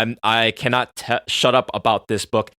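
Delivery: ordinary talk, nothing marked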